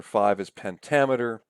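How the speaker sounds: ordinary delivery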